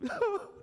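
a sudden high burst of laughter; high delighted laugh. Pretend. Pastor mimicking a person whose debt is paid, high laugh. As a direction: laugh in high disbelief at good news.

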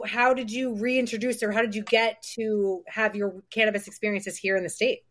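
usual speaking voice

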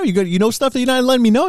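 high-pitched mock indignation; acted-out accusing objection; retold protest. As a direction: imitate an incredulous challenge, pitch jumping up. Retold Eddie challenging Venom's secret.

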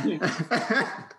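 giggling through words; laughing it off. Real, not acted. Laughing while moving on.

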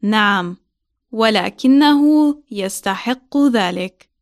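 slow, careful answer. Shown as a demonstration. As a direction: say it slowly for beginners. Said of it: "One more time, slowly".

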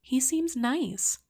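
high, slow gentle reassurance; motherly. Acted. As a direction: say it kindly and slowly. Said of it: "her voice motherly".

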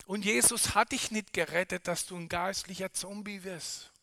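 ordinary talk, nothing marked

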